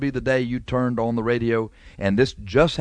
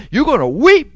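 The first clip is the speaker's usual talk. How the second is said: much higher and louder, near a shout; thundering warning; 12 semitones higher, 9 dB louder than usual. Acted. Preacher warns the lying brother he will weep, shouting. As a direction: cry it out over the congregation.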